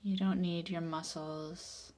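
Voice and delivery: ordinary talk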